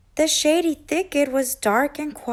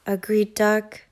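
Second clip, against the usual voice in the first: ordinary delivery, nothing marked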